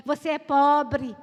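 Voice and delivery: put-on boy yelling "você é pobre" at recess; loud mock taunt. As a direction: hammer the insult "pobre" like a schoolyard bully. Boy chasing her at recess.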